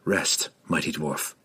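low, soft reassurance; comforting. A narrator's reading. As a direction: soothe the exhausted dwarf to rest. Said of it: "he said comfortingly".

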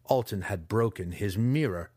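usual speaking voice

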